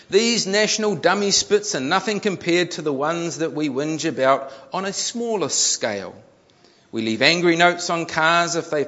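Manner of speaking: high, emphatic reading voice; article read-aloud. A narrator's reading. Preacher reads article on whinging to congregation, emphatic read-aloud. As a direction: read it out with mock outrage.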